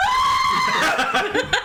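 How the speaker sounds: high shrieking goat bleat; goat scream. Acted. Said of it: "one of them goes".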